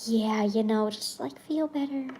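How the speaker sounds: half-hearted mom reassurance; dismissive mom. Retold or imitated. Mom brushes off her upset daughter, soft and dismissive. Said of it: "She's just kinda like".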